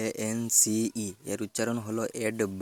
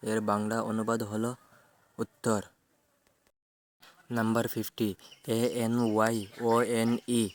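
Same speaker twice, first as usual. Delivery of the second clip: ordinary talk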